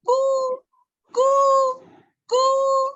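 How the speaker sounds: high, sustained imitated pigeon coos; three long, high, level coos. Pigeon outside Ben's window, imitated high cooing. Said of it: "he heard a noise outside his window".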